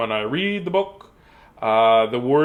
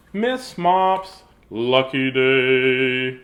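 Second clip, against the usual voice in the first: very slow, drawn-out title reading; storybook title announcement; 2 semitones lower, 3 dB louder, much slower than usual. Acted. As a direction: stretch the title out for children.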